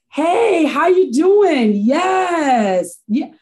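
light up as a friend calls, slow and sing-song on "how you doing"; mother picking up a friend's call, greeting her with loud high delight; cheery phone greeting. Retold or imitated.

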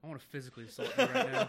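fast, loud, laughing fake threat; laughing mock threat. Real, not acted. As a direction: threaten him while cracking up.

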